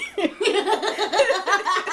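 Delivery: loud, high real laugh; laughing hard at the story; burst of laughter. Real, not acted. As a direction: crack up laughing.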